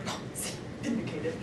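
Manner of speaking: whispered self-satisfied aside; mock vindicated murmur; smug whisper. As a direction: whisper it like a petty victory.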